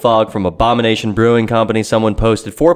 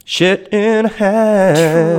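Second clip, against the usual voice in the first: very slow, high, louder put-on exclamation; hammed-up slow outrage; drawn-out mock exclamation; 9 semitones higher, 4 dB louder, much slower than usual. Real, not acted. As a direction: stretch every word out in disgust.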